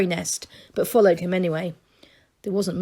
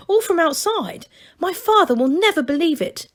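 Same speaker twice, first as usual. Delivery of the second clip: high, eager gnome voice; breathless wonder at the outside; excited young gnome; 10 semitones higher, 4 dB louder than usual. A narrator's reading. A young gnome tells Masklin about outside, high and eager.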